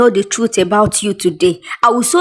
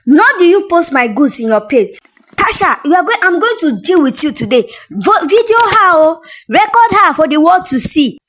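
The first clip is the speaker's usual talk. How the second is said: furious accusation; 7 semitones higher than usual. Acted. Brand owner shouting at Tasha in the market, furious. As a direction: tear into her in public, furious. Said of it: "angry brand owner confronting Tasha".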